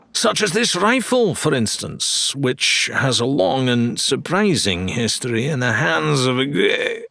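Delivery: high, grandiose salesman patter; showman dealer. Acted. Dealer Wilbur pitching a blunderbuss, grandiose showman voice. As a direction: sell it like a carnival barker.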